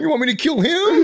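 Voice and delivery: high, loud frantic offer; squeaky desperation. Retold or imitated. Comic offering to kill his friend, squeaky and frantic. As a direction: squeal it eagerly to save yourself. Panicked bargaining with chef.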